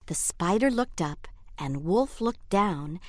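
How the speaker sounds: plain reading voice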